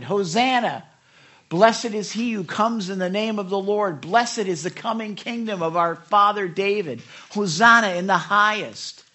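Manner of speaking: crowd shouting. Acted. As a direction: call it out like a jubilant crowd. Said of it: "those who followed shouted".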